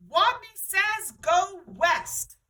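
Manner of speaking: loud, high slow Wadney says; booming. A narrator's reading. Wadney commands the players, booming. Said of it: "In a voice so strong".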